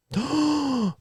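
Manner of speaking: high theatrical gasp; teacher faking amazement at the answer, a high gasp; mock gasp. Mock discovery in skit.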